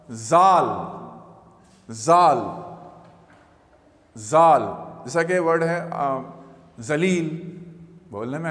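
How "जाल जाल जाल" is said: जाल with a buzzing z at the start; z sound. Shown as a demonstration. Teacher showing students जाल with a z sound. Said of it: "ये जेड की आवाज़ के साथ".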